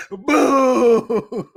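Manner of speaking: loud mocking boo. Real, not acted. Streamer booing a chat comment, loud mocking boo. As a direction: jeer it out like a heckler.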